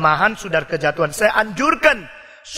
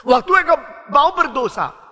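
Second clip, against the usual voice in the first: ordinary talk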